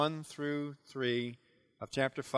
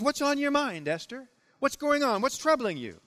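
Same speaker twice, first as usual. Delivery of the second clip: the king asking eagerly, pitched up; a curious king pressing her gently; the king leans in to ask her; 8 semitones higher, 3 dB louder than usual. Acted. Voicing the king's eager question.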